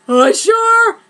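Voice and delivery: high, loud startled cry of a name; shocked gasp. Acted. Clarity spotting Usher in the classroom, shocked high cry. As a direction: yelp his name in disbelief.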